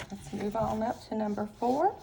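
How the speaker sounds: ordinary delivery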